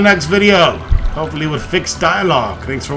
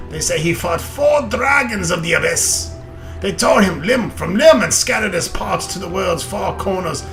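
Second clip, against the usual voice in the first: loud, higher hearty dwarf; 5 semitones higher, 3 dB louder than usual. Acted. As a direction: boom it out like an old dwarf. Reads dwarf lines in character.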